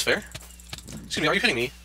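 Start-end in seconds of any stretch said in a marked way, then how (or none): none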